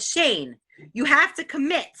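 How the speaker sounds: friend insisting, pitch jumps up on commit; mock bossy friend; firm insistence. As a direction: push her to commit. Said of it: "And she said".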